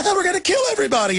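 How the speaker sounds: high, fast mock-giddy squeal; gleeful villain voice. Host mocking control freaks thrilled by killing, giddy squeal. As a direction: sneer it like a giddy little villain.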